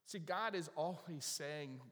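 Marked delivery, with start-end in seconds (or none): none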